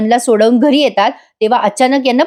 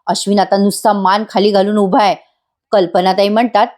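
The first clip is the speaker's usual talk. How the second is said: usual speaking voice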